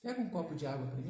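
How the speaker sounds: quick, low, casual ask; gentle request. Shown as a demonstration. Father asks daughter for a glass of water, gentle request. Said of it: "eu posso falar assim".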